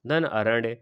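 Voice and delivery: normal talking voice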